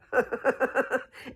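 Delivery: quick high burst of laughter; short amused laugh; bright laugh. Real, not acted. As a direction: laugh out loud at your own joke. Laughing at her own point.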